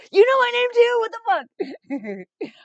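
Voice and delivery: squealing, very high and loud, then drawn out; meeting a comedian who knows her name, squealing; starstruck squeal. Retold or imitated. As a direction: gush like a starstruck fan. Starstruck reaction to Butterly.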